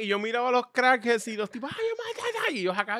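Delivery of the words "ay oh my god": much higher squealing tourist imitation; mock a delighted tourist, high and thrilled; excited tourist. Mocking tourists on carousel horses. (turista emocionado imitado con voz muy aguda)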